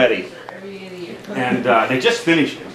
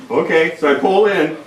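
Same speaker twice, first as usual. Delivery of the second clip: usual speaking voice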